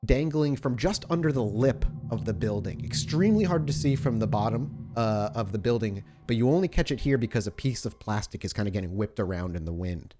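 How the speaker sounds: ordinary talk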